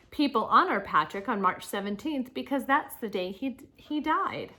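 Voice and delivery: projected, lilting read-aloud with punched openings; reading to children. Acted. Teacher reads Saint Patrick's life story to children, animated read-aloud. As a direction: read clearly to the class, lifting key words.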